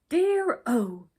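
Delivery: loud, high dismayed exclamation. A narrator's reading. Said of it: "he exclaimed".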